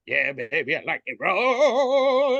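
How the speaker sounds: high, slow, stretched-out RAW; sleazy brain. Retold or imitated. Brain pestering the sleeping girl, high slow croon. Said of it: "And the brain's like".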